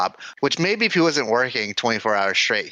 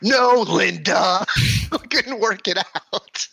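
imitating Bob; 10 semitones higher, slower than usual. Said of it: "my favorite line of the whole episode".

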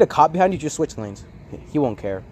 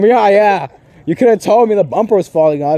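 raised, louder retort acting out an argument; retold indignation; 5 semitones higher, 6 dB louder than usual. Driver scolding his friend over the fallen bumper, retold indignant. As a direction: replay your indignant comeback, higher and louder.

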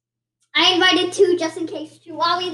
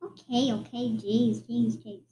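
quiet mumble; 6 semitones lower, 7 dB quieter than usual. Acted. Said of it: "Come on, speak up".

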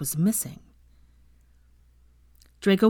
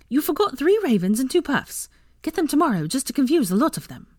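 high-pitched, bossy plotting; eager girl conspirator; 8 semitones higher than usual. A narrator's reading. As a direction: give orders in an eager high voice. Voices the eager young conspirator.

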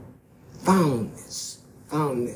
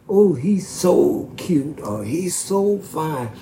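imitating gushing girls; 4 semitones higher, 5 dB louder than usual. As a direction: mimic teenage girls swooning, lightly. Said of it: "they would say".

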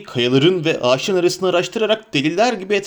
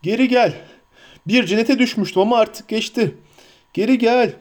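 high, raised plea; calling him back; 8 semitones higher than usual. A narrator's reading. Boromir begging Frodo to return, calling out high. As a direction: beg him to come back. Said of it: "diye seslendi".

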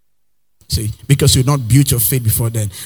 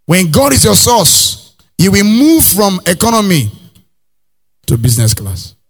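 preaching at full force; 5 semitones higher than usual. Acted. Pastor preaching to the congregation, rising and slow. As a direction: build it up like a pulpit climax.

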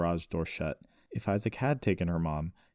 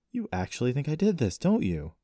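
hurt, higher-pitched disbelief; betrayed; 7 semitones higher than usual. A narrator's reading. Brother to sister who accuses him, betrayed. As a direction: say it as one wronged by family. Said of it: "sounding betrayed".

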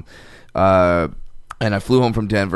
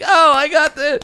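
put-on much higher and louder, a thrilled little kid; excited kid; 4 dB louder than usual. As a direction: squeal it like a kid who just won a prize. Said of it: "and the kid's like".

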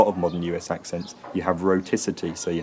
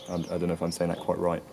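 ordinary talk, nothing marked

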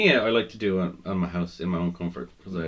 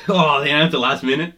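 mock surprise; 6 semitones higher, 7 dB louder than usual. Mock surprise at late announcement.

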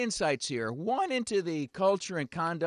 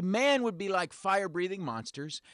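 usual speaking voice

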